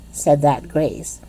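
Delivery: usual speaking voice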